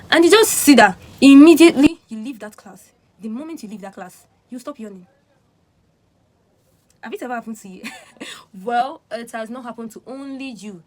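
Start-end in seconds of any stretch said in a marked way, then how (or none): none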